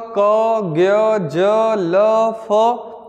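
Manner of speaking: each letter drawn out on its own; slow list of single letters. Shown as a demonstration. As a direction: recite क, ज्ञ, ज, ल, फ as separate, lengthened sounds. Spelling out a meaningless letter string.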